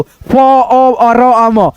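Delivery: फ, अ, र, म said one sound at a time. Shown as a demonstration. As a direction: break the word into separate sounds फ, अ, र, म. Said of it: "लेकिन हम पढ़ रहे हो". (फ, अ, र, म अलग-अलग ध्वनियों में)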